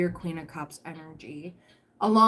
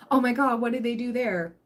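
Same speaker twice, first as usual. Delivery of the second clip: a louder, higher "oh my God" as if panicking; mock alarm; 4 semitones higher, 4 dB louder than usual. Tarot reader voicing the anxious partner's panic, mock alarm. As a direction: play the worried partner suddenly alarmed.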